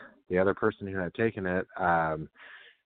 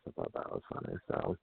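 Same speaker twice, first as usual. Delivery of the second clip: whispered; 12 semitones lower, 10 dB quieter than usual. Real, not acted. Unvoiced aside after laugh.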